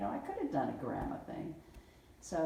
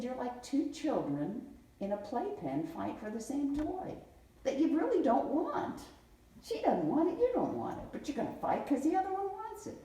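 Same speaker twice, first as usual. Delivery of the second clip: higher, louder scolding of two bickering adults; exasperated lecture; 11 semitones higher, 4 dB louder than usual. Retold or imitated. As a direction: tell them off like squabbling kids. Lecturing the arguing pair.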